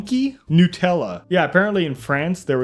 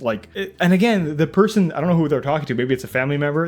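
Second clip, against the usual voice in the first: usual speaking voice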